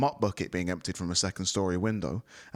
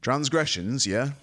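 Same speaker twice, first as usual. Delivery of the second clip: nonchalant; 6 semitones higher, 5 dB louder, slower than usual. A narrator's reading. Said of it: "asked Artoris nonchalantly".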